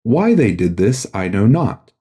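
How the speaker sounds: ordinary delivery, nothing marked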